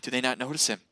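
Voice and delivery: normal talking voice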